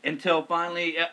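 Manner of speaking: ordinary delivery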